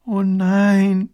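high, drawn-out moan; groaning princess. A narrator's reading. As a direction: groan in dismay. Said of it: "stöhnt die Prinzessin".

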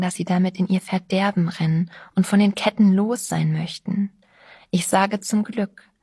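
usual speaking voice

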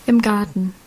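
ordinary delivery, nothing marked

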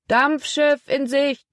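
high, slow call across the water; man calling from shore. A narrator's reading. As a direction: call it out from the riverbank. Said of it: "Vom Ufer erklang die Stimme eines Mannes". (langgezogener Ruf vom Ufer)

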